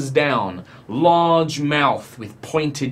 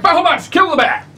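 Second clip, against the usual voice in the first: high, loud urgent command; shout; 13 semitones higher, 4 dB louder than usual. Acted. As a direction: shout the order at the dragon. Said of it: "And he just shouts".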